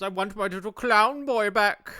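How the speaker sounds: high, loud grieving shout; shouting clown dad. Retold or imitated. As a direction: yell it like a wailing clown father. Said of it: "he shouts".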